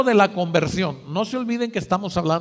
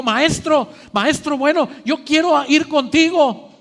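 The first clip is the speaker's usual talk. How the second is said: eager plea; 6 semitones higher, 4 dB louder than usual. Acted. Eager man asking to follow Jesus.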